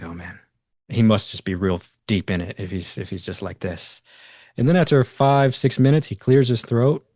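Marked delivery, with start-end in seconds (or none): 0.0-4.0 murmured almost under the breath, much softer; quietly voicing a private worry; hushed worried thought. Teller recalls fearing the guru was lost, hushed.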